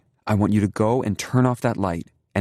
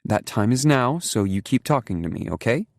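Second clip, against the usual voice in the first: reassuring; 7 semitones higher, 3 dB louder than usual. A narrator's reading. Mother calms child on phone.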